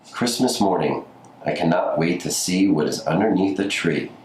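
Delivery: plain reading voice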